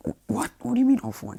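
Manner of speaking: high-pitched, stammering disbelief; startled, incredulous question; acted shock. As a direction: blurt it out like you can't believe it. All Might shocked by the revelation.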